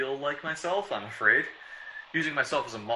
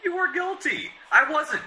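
much higher, louder protest; heated denial; 5 dB louder, slower than usual. Acted. Devereaux denying guilt to Kerrigan, shrill and loud. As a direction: insist desperately on innocence.

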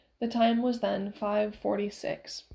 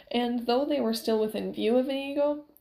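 ordinary delivery

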